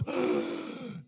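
loud unvoiced gasp of air; deep gasp. Preacher showing revival as a loud gasp.

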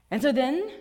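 ordinary talk, nothing marked

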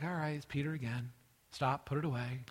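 low, soft, resigned order; retold low-key scolding; weary reproof. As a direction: quietly tell him off as a resigned Jesus. Weary Jesus tells Peter to stop.

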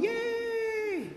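very high, thin pretend cheer; mock cheer. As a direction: squeal a fake happy yay. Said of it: "she wasn't going".

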